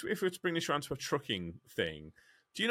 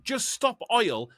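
higher, slow, sarcastic chant; mocking slogan; 10 semitones higher, 5 dB louder, much slower than usual.

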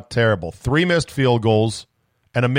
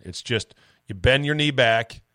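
normal talking voice

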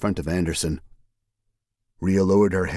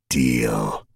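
low, satisfied single word; villain's deal; 8 semitones lower than usual. A narrator's reading. Villain Anderson accepting the deal, low and smug. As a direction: seal it smugly.

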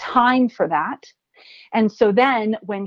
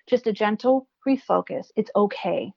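ordinary talk, nothing marked

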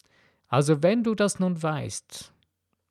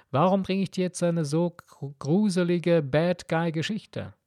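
usual speaking voice

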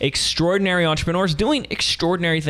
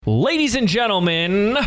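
TV announcer; 7 semitones higher than usual. Shown as a demonstration. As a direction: boom it out like a fifties announcer. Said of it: "I sound like a T V announcer or something".

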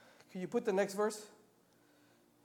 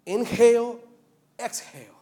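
"Inhale" raised, "exhale" dropping away; breathing demonstration; 5 semitones higher, 8 dB louder than usual. Shown as a demonstration. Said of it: "replicar la respiración".

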